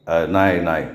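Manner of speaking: low, hesitant stammer. A narrator's reading. Son stammers a reply to his angry father, hesitant. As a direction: stumble over the answer. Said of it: "भुजंगाने चाचरत उत्तर दिलं".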